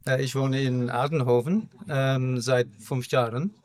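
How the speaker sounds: German spoken with an English accent; English speaker, halting German. Real, not acted. Dass mein Deutsch ist nicht so gut.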